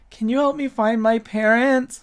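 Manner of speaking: put-on high pleading child voice; small lost kid asking, pitched right up; little boy. Game master as boy tugging at Kite's robes, pleading child voice. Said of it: "and he's just like".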